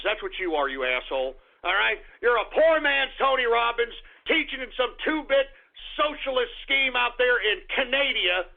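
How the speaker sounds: normal talking voice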